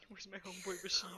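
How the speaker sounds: whispering. Real, not acted. Unvoiced aside.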